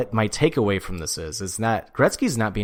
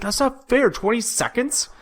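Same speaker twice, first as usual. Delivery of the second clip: indignant protest; 13 semitones higher than usual. Retold or imitated. Imitating Biz objecting to his time limit, indignant. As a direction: imitate an outraged, disbelieving complaint, pitched way up.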